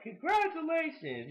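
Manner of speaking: high, sing-song "Congratulations"; mock sarcastic congratulations. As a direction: tease him with fake cheer. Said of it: "was like".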